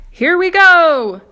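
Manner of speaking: very loud, high, slow whoop; excited shout. Acted. Grasshopper hopping with excitement, slow loud whoop. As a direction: hold the cry out long. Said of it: "shouted the old green grasshopper".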